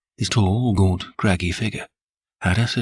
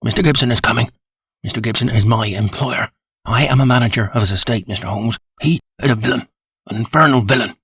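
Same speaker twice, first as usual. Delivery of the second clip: short breathless sentences, pitched higher and louder; out of breath; 3 semitones higher, 4 dB louder than usual. Acted. Frightened manager warns Holmes, breathless and higher. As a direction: rush it out in gasps. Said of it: "like a man who is out of breath".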